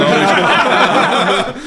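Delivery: laughing. Real, not acted. Laughing denial.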